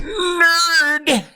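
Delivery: high squealed tease; nerd. Real, not acted. Teasing her friend for the Dungeons and Dragons talk, squealed high.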